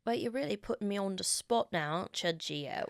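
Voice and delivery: polite, hushed attempt at a British accent. Shown as a demonstration. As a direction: put on your best British, quietly. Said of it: "do your best British accent".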